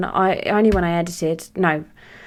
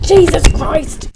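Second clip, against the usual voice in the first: much higher and louder, drawn out slowly; exasperated groan; 10 semitones higher, 10 dB louder, much slower than usual. Real, not acted. Woman fed up with glitter on her eyes, loud groan. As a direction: drag it out in disbelief.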